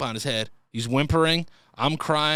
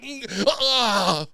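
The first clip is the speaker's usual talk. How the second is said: Mel Gibson grunt; 7 semitones higher than usual. Retold or imitated. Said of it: "He's like".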